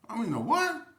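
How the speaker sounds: loud, high, incredulous question; shocked disbelief. Retold or imitated. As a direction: blurt it out in mock shock. Shocked reply to the news.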